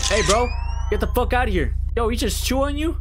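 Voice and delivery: high, loud scolding shouts; angry yelling. Real, not acted. Gamer yelling at the game character, high and loud anger. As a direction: bark at him with fury.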